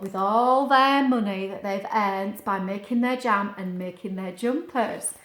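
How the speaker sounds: ordinary delivery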